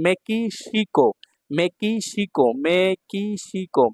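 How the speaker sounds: মেকিসিকো slow, syllables stretched. Shown as a demonstration. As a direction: sound out মেকিসিকো beat by beat. Teacher sounds out new word.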